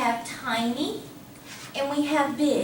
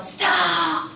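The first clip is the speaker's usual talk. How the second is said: abrupt loud, higher-pitched shout; sudden yell; 4 semitones higher, 5 dB louder than usual. Shown as a demonstration. Teacher testing drivers' reaction times, sudden yell. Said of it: "I'm gonna yell".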